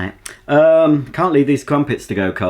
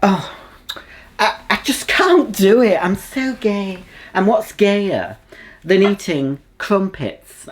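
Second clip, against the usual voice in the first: higher, slower camp drawl; 9 semitones higher than usual. Acted. To the viewers over crumpets, a higher, slow camp voice. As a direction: play up the flamboyant camp act.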